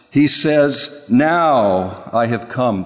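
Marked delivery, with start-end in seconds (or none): 1.1-2.9 NOW leaned on, whole line slow and weighty; slow solemn declaration; emphatic now. Acted. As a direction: land hard on "Now" and draw out "I have come". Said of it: "The now in the Hebrew is emphatic".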